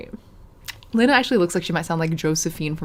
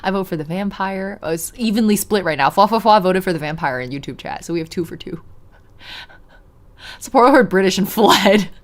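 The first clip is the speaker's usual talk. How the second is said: normal talking voice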